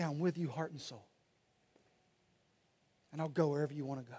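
softly, a tearful wife's quiet pledge; tender devotion. Retold or imitated. Wife moved to tears promising to follow her husband, soft and tender. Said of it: "she'll say".

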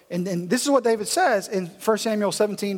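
usual speaking voice

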